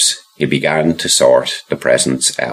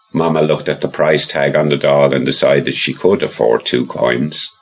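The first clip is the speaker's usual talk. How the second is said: ordinary delivery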